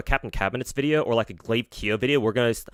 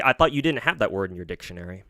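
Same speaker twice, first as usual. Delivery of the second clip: ordinary talk, nothing marked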